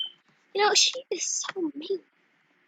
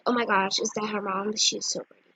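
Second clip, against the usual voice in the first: ordinary talk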